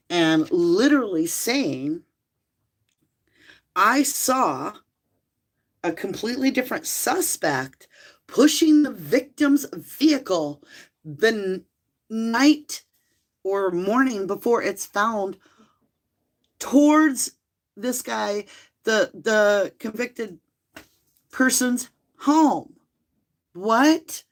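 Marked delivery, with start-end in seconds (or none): 3.7-22.7 louder and higher, building to outrage; worked-up reading. Acted. Reads outraged eyewitness affidavit aloud. 23.5-24.2 loud, high, rising what; can't believe what you just read; stunned what. Real, not acted. Disbelief at the case.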